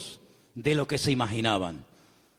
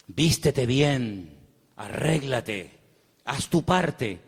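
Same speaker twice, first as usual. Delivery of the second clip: slow urging; 3 semitones lower, much slower than usual. Acted. Slow repeated instruction.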